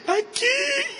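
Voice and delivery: put-on very high, whimpering child; crying little boy. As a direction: whine it like a hurt kid. Hurt son answers, crying.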